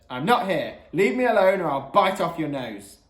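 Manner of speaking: rising to a loud warning; angry voicemail greeting. Acted. Mum's voicemail warns callers away, angrily.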